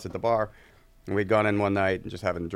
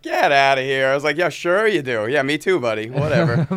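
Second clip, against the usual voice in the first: loud, higher-pitched mocking brush-off; sarcastic disbelief; 6 semitones higher, 9 dB louder than usual. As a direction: scoff at an unbelievable claim, loudly. Retold disbelief at stranger's claim.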